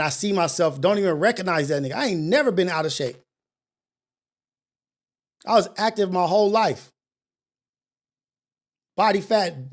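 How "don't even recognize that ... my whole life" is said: ordinary delivery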